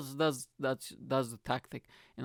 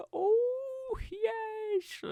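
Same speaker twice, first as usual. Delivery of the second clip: squealing glee. Acted. Gleeful squeal about Tinder.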